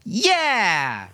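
put-on high, eager child's yeah; a small sister joining in; little girl chiming in. A narrator's reading. Rosa backing up her brother, high and eager. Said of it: "chimed in his sister".

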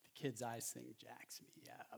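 whispered, choked up; hushed, barely holding it together; a real whisper after the touching clip; moved whisper. Real, not acted.

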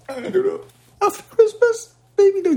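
glitchy dropout on a bad line; choppy internet connection; buffering stutter. Real, not acted. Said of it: "you cut out for a sec".

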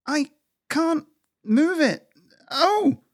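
very high, slow, effortful squirrel voice; straining squirrel. A narrator's reading. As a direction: strain against a heavy boulder, squeaky high.

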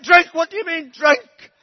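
put-on high, loud, rising cries on drunk; scandalised mother repeats the word; mother demands what drunk means; outraged disbelief. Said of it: "She said".

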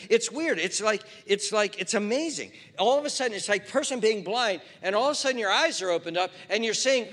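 loud, high, driving sermon voice; full-force preaching. Acted. Pastor pressing the congregation about sin, loud preaching.

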